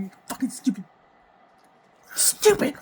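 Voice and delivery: reliving yelling at herself in the mirror; a retold yell that bursts out on the second "Stupid"; retold self-scream. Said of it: "I scream at myself in the mirror".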